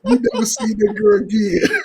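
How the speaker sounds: high, laughing through the friend's line; laughing quote. Retold or imitated. Friend teasing Mike, laughing through the quote. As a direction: laugh while quoting him. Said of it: "He said".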